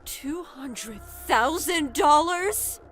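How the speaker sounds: slow, high incredulous question; shocked mother. Acted. Mother hears the amount her son wants, shocked. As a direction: echo the sum in slow disbelief.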